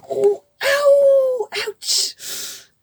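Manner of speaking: high yelping ow and ouch; pained cries. Acted. Giant stepped in thorns, high pained yelps. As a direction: cry out in sudden pain.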